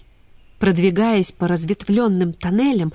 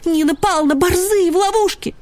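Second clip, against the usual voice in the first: urgent plea; 11 semitones higher, 3 dB louder than usual. Acted. Ksyusha pleading urgently.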